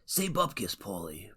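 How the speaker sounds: high, hushed movie-quote voice; badly faked gangster character, tailing off softly; terrible mobster impression. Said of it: "Those are terrible impressions".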